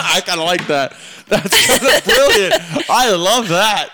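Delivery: high, loud laughing approval; helpless amused whoop through the words; laughing delight. Real, not acted. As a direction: crack up while praising it. Cracking up at crude chant.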